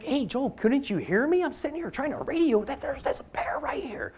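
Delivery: whispered, high and urgent, so the bear won't hear; hushed whisper. Retold or imitated. Hunter whispering to his partner about the bear, hushed urgent whisper. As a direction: keep it to a breathy hiss, don't spook the animal.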